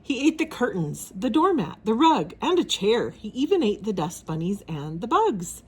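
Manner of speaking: ordinary delivery, nothing marked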